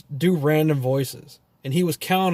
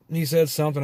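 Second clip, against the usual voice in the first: normal talking voice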